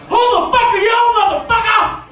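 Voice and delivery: high, loud acted fury; enraged yell. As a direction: roar a threat at an intruder. Enraged man with a bat yells.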